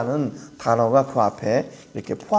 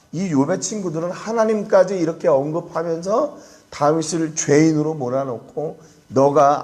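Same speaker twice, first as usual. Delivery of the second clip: ordinary delivery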